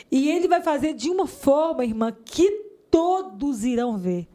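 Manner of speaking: slow, high, emphatic proclamation; retold authoritative prophecy. Church brother prophesying to a weeping woman, authoritative. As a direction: declare it with preacher's authority. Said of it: "ele falou com tanta autoridade".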